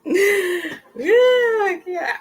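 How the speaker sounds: high laughing yeah; amused high-pitched agreement. Real, not acted. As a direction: let the yeah break into a laugh. Laughing after freaks joke.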